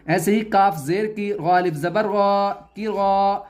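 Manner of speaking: ordinary talk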